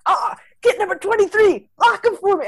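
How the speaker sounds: high, loud football yell; hammed-up game-day shout; mock shouting. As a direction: imitate a player yelling across the field. Mocking dialogue heard in the movie.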